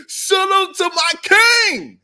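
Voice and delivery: high, loud party shout; a loud shout-out to a friend; shouted salute. Retold or imitated. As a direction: call it out across the room. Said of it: "we say".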